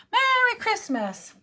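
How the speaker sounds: high, slow, bright Merry Christmas; cheery reveal. Real, not acted. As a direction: announce the card with delight. Reveals finished card.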